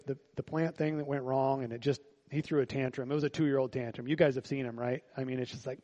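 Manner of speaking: usual speaking voice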